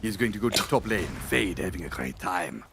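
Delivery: a lightly put-on French accent for the Pangolier. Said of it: "we do a French accent now".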